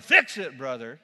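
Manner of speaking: loud retold yell, dropping on brother. Man in the congregation yelling at the preacher. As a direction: shout the call, then let it fall. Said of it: "'Cause yelling".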